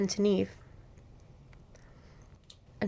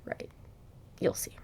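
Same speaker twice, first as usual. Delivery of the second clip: whispered tease; 13 dB quieter than usual. Real, not acted. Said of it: "teasing the listener".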